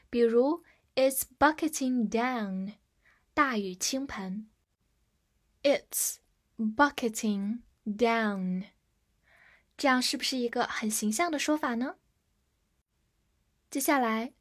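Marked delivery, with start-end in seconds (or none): 5.6-8.7 slow, with pauses between words; slow model reading. Shown as a demonstration. Teacher repeats the example for students, slow and measured. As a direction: space the words out for learners.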